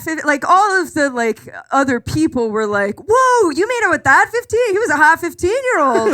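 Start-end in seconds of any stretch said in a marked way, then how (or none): 3.1-6.1 high, teasing gush; mock the friends swooning over him; giddy mock gossip. Friends teasing about the boy.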